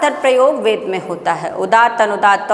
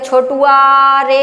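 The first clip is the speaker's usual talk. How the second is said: long drawn-out call; 3 dB louder, much slower than usual. Shown as a demonstration. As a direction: stretch the call out slowly. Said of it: "ये आवाज़ क्या करते हैं? बहुत देर तक निकालते हैं". (लंबा खींचकर पुकारना)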